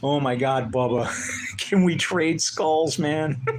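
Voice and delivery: laughing through the words, slow. Real, not acted. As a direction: giggle out a joke to a friend. Laughing at friend's comment.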